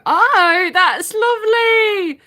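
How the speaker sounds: high slow moved voice; tender, choked-up praise; touched. Real, not acted. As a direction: sound genuinely touched. Touched by viewer's answer.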